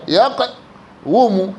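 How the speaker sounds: "يقوم" very slowly as yaq, pause, wumu; underlying form. Shown as a demonstration. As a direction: split "يقوم" after a stopped q, then wu-mu. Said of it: "والأصل هكذا". (نطق "يقوم" مقطّعة: يَقْ - وُمُ)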